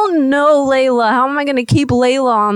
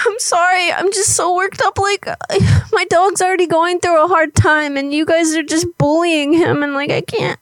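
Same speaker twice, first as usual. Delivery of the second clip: higher, worked-up mock sobbing; fake crying; 5 semitones higher than usual. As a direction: overwrought prank caller, fading as the words fail. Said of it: "I can fake cry".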